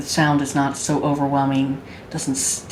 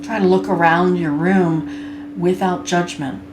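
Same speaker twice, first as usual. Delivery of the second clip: guided meditation. Acted. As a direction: guide them gently into body awareness, unhurried.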